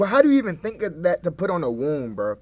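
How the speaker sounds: normal talking voice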